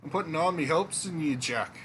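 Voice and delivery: put-on untrained movie Scottish accent for a king; a bluff Scottish king, in a movie Scottish accent. King recruiting the hero Jack, in a Scottish accent. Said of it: "my Scottish accent".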